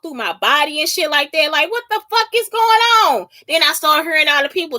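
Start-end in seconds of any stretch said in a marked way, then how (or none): 1.7-3.3 high, bewildered question; retold alarmed confusion, pitch raised; incredulous outburst. Speaker relives being talked through her body, bewildered. Acted reaction to hearing voices.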